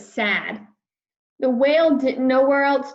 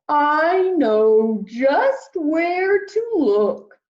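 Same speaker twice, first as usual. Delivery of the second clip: big, louder whale greeting; friendly whale voice; 6 semitones higher, 4 dB louder, much slower than usual. Acted. Whale greeting lost Peter, big and friendly. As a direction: boom out a kindly hello.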